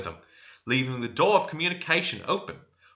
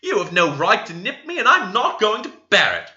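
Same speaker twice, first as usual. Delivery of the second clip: high and loud outcry; a pinched servant yelling at her mistress; screamed protest; 10 semitones higher, 5 dB louder than usual. A narrator's reading. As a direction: shriek it out in pain and outrage. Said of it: "screamed out".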